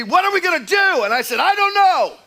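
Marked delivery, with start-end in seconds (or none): 0.0-1.0 high, loud yell while running; panicked yell. Retold or imitated. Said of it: "Brian yells at me". 1.4-2.2 high, loud, helpless yell; frantic shout. Retold or imitated. Shouted reply during the chase.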